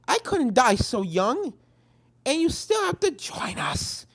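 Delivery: dramatic pony. Acted. Friend voice.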